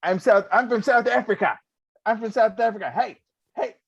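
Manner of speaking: put-on high, loud South African accent shout; drunken-style South African announcement. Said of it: "I just ran around saying".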